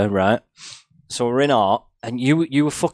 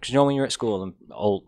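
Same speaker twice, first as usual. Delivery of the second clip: normal talking voice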